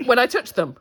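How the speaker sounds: normal talking voice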